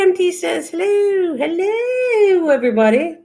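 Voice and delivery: high, swooping comic greeting; Missus Doubtfire. Acted. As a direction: hoot it in a put-on high voice. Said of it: "Missus Doubtfire".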